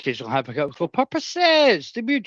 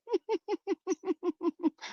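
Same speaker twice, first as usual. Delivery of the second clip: high laugh; 6 dB quieter than usual. Real, not acted. Laughs at own joke.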